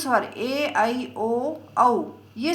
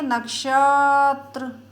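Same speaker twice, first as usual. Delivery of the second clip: नक्षत्र called long and loud; calling out to a far friend; 4 dB louder, much slower than usual. Shown as a demonstration. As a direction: call नक्षत्र across the distance, stretching the end. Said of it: "आवाज़ लगाएंगे तो कैसे लगाएंगे". (दूर खड़े दोस्त को पुकारते हुए)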